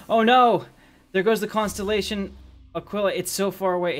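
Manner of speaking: high, loud mock alarm; dramatic dismay. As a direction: cry out in hammed-up alarm. Dramatic reaction to drifting into space.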